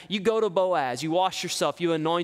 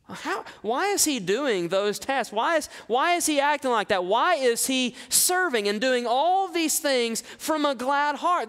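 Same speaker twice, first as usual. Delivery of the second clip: puzzled onlookers; 9 semitones higher than usual. Acted.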